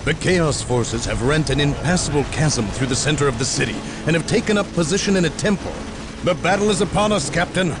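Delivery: high, fast, pressed delivery; urgent battle report. Acted. Soldier reports enemy positions to captain, urgent. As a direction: call it in over the fighting, fast and high.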